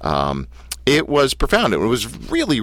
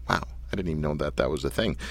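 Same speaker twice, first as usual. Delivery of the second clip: quick, hushed aside; quiet aside; 7 semitones lower, 10 dB quieter, faster than usual. Real, not acted. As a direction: mutter it off to the side.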